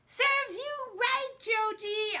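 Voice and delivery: high, loud, slow jeering yell; yelled taunt. Acted. Girl yelling at her brother after losing the argument. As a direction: drag it out to rub it in. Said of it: "I yelled".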